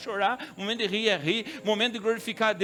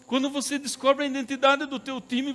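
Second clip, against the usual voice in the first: higher, emphatic preaching voice; fervent preaching; 7 semitones higher than usual. Acted. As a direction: press the question home. Preaching climax. (pregação enfática, mais aguda)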